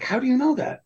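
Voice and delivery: very high-pitched amazed question; mock astonishment. A colleague is amazed the narrator knew the word, mock astonished squeak. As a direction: squeak it out in disbelief.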